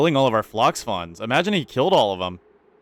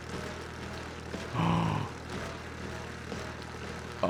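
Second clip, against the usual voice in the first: long breathy shocked gasp; sharp gasp; 14 dB quieter than usual. Real, not acted. Streamer spotting the broken liquid lock, gasping. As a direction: suck in air in alarm.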